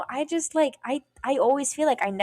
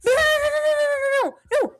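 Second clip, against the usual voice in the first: very high, loud string of mock-frantic protests; imitated panicked friend; 6 dB louder than usual. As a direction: squeal it like a friend cutting in. Friend frantically stops her confession.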